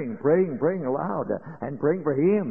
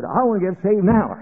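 loud, higher, urgent cry; urgent plea; 5 semitones higher, 7 dB louder than usual. Retold or imitated. Scared man tells the preacher he wants saving now, loud urgent plea. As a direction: blurt it out like a frightened man begging. Frightened man begs for salvation.

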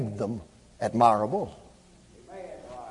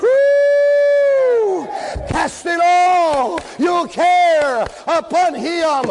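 high, drawn-out revival preaching shout; whooping Southern-style sermon climax; full-force preaching; 11 semitones higher, much slower than usual. Acted.

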